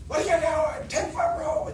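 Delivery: high, loud mock yell at a worker; retold scream. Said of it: "I screamed at him".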